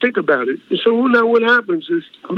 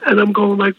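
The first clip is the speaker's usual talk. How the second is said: ordinary talk, nothing marked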